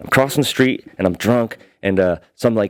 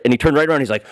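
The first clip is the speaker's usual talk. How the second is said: ordinary delivery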